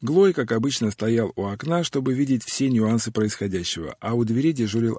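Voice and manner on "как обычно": plain reading voice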